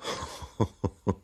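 soft, breath-only chuckle; small voiceless laugh, performed; light acted laugh. A narrator's reading. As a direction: laugh lightly in little puffs. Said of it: "Risi io, lievemente".

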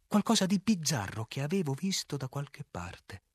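ordinary delivery, nothing marked

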